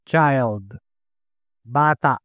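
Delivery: ordinary talk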